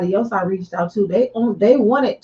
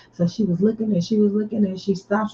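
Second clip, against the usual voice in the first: usual speaking voice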